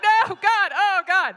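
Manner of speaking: very high, very loud horrified cry; panicked yell. Retold or imitated. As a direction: shriek in horror at the child. Son eats dog turd.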